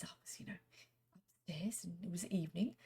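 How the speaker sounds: hushed retold whisper. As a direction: whisper it like a secret. Retold ghost story, hushed.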